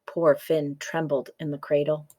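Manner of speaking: plain reading voice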